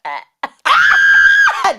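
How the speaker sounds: loud, high-pitched shriek with laughter. Real, not acted. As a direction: burst into a piercing laughing scream. Shrieks after finishing own rap.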